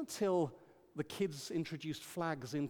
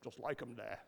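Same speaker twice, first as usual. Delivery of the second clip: mumbled reply; 5 semitones lower, 10 dB quieter than usual. Retold or imitated. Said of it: "And I went".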